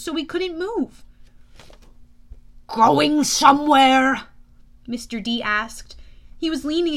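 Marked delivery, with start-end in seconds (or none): 2.7-4.2 slow, loud, taunting question; loud, drawn-out gotcha; menacing challenge. Acted. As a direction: draw it out to catch a runaway. Mister D confronts the escaping hero.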